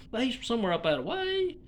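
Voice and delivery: usual speaking voice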